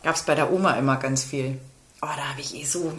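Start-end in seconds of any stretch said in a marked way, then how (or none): 2.0-2.9 soft unvoiced reminiscing; whispering. Real, not acted. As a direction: whisper it, lost in sweet memories. Savouring sweets memory.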